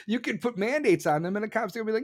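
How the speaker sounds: normal talking voice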